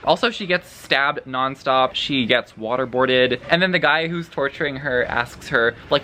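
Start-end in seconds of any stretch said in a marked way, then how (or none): none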